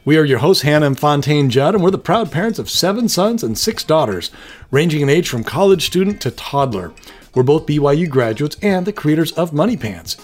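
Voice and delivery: brisk, projected podcast host read; upbeat show intro. Acted. Host welcoming listeners to the Superpower Hour, upbeat intro read. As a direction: welcome listeners with lively scripted emphasis.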